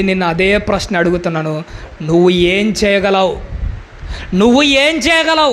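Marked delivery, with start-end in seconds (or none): 4.3-5.5 higher, pressing repeat of the question; insistent challenge. Real, not acted. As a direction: challenge the congregation with raised pitch. (గొంతు పెంచి సవాలు చేస్తూ)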